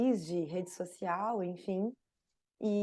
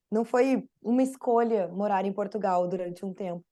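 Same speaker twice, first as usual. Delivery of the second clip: ordinary talk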